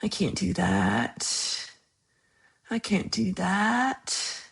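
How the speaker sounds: soft, very slow try at Scottish; attempted Scottish accent. Shown as a demonstration. Streamer admitting she can't do Scottish, attempted Scottish accent. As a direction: feel out a Scottish accent quietly and slowly. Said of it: "I don't have a Scottish accent".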